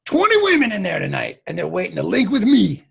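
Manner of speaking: loud brag soaring on MILE; hammed-up boast. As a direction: show off like a flamboyant braggart. Same flamboyant boast.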